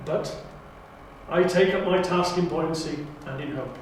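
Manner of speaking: rousing Time Lord oration, building loud; loud, stately audition monologue; grand speech. Acted. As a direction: declaim it like a proud lord rallying his people.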